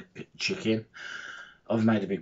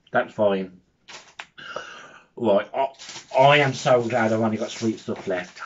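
ordinary talk

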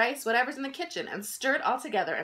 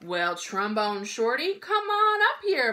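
inviting call; 4 dB louder, slower than usual. Acted. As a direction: welcome him up on stage. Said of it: "Bo Diddley said".

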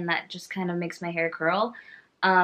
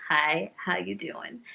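ordinary talk

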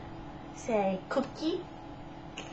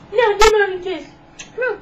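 loud insistent coaxing; 9 semitones higher, 11 dB louder, much faster than usual. Real, not acted. Insisting to baby.

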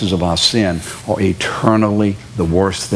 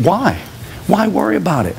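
ordinary talk

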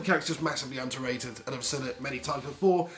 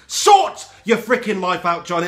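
loud, drawn-out scolding shout; exasperated telling-off; 3 semitones higher, 8 dB louder than usual. Acted. Fan addressing Johnny Evans, exasperated shout. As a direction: yell it slowly at the player. Tells off player as if present.

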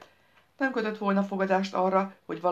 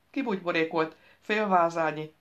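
plain reading voice

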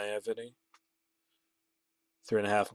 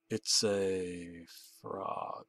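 very slow, soft and low "It's a frog"; drawn out; 4 semitones lower, 10 dB quieter, much slower than usual. Acted. Caller teasing the narrator over the phone, slow and drawn out.

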